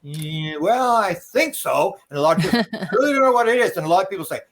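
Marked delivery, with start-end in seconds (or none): 0.0-2.0 drawn-out, unsure imitation; mimicking someone hedging; hesitant. Voicing unsure people asked about mission. 2.7-3.8 higher-pitched mock bewilderment; mimicking a baffled person; imitated cluelessness. Voicing clueless people asked about mission.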